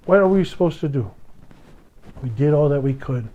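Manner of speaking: normal talking voice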